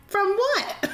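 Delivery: high incredulous question; mock disbelief. Scoffing at people who push baptism, high and incredulous. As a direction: toss it back in mock disbelief. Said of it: "I'm like".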